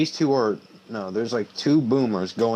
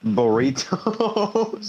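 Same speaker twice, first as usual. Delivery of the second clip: silly voice; 10 semitones higher, much slower than usual. Pretend. Silly burrito voice.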